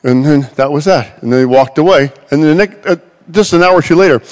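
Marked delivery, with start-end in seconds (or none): none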